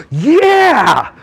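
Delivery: realization yeah. Retold or imitated. Speaker realizing he was that kid, excited exclaim. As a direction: burst out with sudden recognition. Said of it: "And I went".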